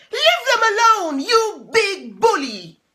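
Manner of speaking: high loud, quick shout at the fox; brave shout. Acted. Tufty shouting at the fox, high and loud. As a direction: stand up to the fox.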